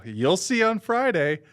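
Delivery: high, slow, drawn-out dodge; evasive retold answer. Moviegoer dodging the counter worker's question, slow high evasion. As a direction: stretch it out slowly and pitch it up.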